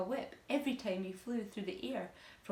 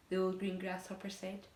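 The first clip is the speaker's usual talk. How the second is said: plain reading voice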